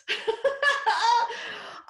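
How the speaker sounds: high loud shriek of laughter; overwhelmed laughing shriek. Real, not acted. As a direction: burst out squealing. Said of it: "I freaking flipped".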